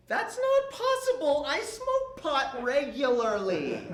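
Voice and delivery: high-pitched protest, slowing on the second sentence; comic, high denial that falls in pitch; disbelieving protest. Acted. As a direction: protest the news as if it's impossible. Disbelieving protest at cancer news.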